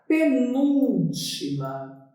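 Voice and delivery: "penúltima" very slow, syllables stretched; writing-out dictation. Shown as a demonstration. Teacher writing the term for students, slow dictation. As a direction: draw out "penúltima" as you write it.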